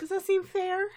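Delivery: high, puzzled teacher voice; Miss Cheerly voice. Retold or imitated. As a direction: ask it dazed and confused.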